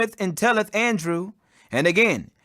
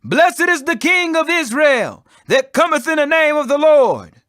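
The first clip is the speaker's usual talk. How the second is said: crowd crying out; 6 semitones higher, 4 dB louder than usual. Acted. Crowd hails the King of Israel, crying out loud. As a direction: hail the coming king loudly. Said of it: "and cried".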